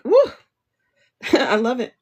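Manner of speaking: ordinary talk, nothing marked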